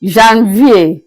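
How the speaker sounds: Janvier as "zhan-vee-AY"; French pronunciation. Shown as a demonstration. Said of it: "January is pronounced as Janvier".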